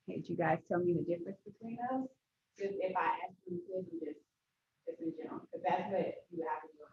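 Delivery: muffled, as if through a wall. Real, not acted. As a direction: far from the mic, dull. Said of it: "You sound muffled".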